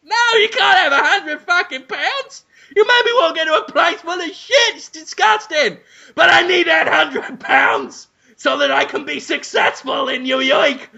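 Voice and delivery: high, strained yelling refusal; exasperated refusal. Acted. Estate agent yells at the buyer, high strained refusal. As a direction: refuse him in a squeaky rage. Voices Dafoe refusing money.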